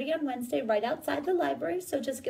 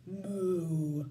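low cow moo; 8 semitones lower, 4 dB quieter than usual. Acted. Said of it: "asked the cow".

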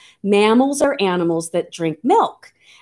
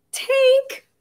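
high sing-out call to a pet; very high-pitched beckoning. Real, not acted. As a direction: coax him out with a high drawn call. Calling the turtle out.

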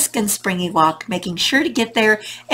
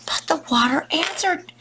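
ordinary delivery

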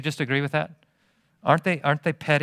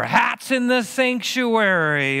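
higher, slow scandalized gasp of a complaint; mock outrage; 7 semitones higher, slower than usual. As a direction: drag it out like a shocked prude. Mocking petty churchgoer complaint.

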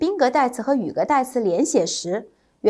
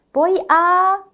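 voy a held high, hanging unfinished; fill-in-the-blank prompt; 6 semitones higher than usual. Shown as a demonstration. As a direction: leave voy a hanging for the answer. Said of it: "第一题". (升调停顿留空)

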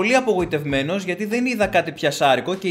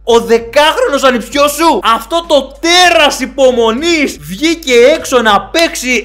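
mock awe; 5 semitones higher, 7 dB louder than usual. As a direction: marvel at the boy like a sports miracle. Hammed-up awe at ten-year-old.

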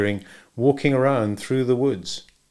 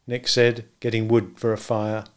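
plain reading voice

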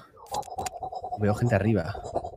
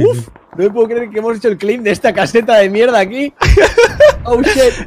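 laughing; 8 semitones higher, 4 dB louder than usual. Real, not acted. Laughing at absurd situation.

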